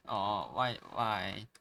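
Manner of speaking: normal talking voice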